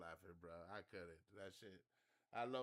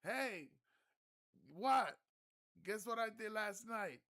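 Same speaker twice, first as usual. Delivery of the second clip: very high, loud teasing yell; mock yell; 13 semitones higher, 12 dB louder, slower than usual. Steven taunting the other guy, high loud yell. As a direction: holler a taunt across the water. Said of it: "Steven goes".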